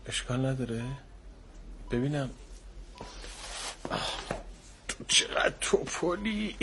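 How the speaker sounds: ordinary talk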